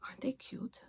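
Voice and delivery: soft whispered aside; hushed teasing whisper. Real, not acted. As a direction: whisper it like a secret.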